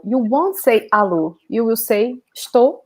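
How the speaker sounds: normal talking voice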